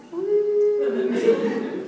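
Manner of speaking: loud high woo; high whoop. Acted. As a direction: whoop with joy. Jumping into the air.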